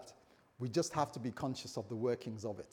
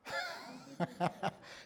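high chuckle to himself; amused laugh at his own admission; 4 dB quieter than usual. Real, not acted. Laughs after "Ask my wife.

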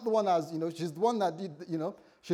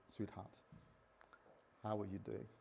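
soft, tender; 5 semitones lower, 11 dB quieter than usual. Retold or imitated. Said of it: "and say".

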